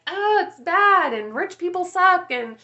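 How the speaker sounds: high, loud whiny mock voice; mock broke mindset. Mocking a money mindset to viewers, whiny high voice.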